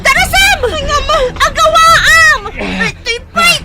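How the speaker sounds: very high, very loud screaming; desperate screams. Acted. Luningning fights back, shrieking for help.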